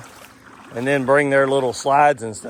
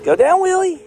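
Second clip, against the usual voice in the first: calling out; 7 dB louder than usual. Real, not acted. Father calling to child on slide.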